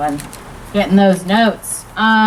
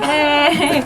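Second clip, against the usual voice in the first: higher, drawn-out hey; eager-to-please greeting; 6 semitones higher than usual. Retold or imitated. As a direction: imitate a hopeful hello to the kids. Greeting kids she dresses for.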